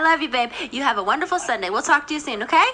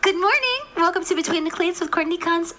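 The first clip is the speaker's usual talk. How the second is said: bright radio host welcome; 4 semitones higher than usual. Acted. Host greets new caller on air.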